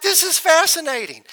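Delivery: fascinated wonder. Retold or imitated. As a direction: lift it in delighted awe.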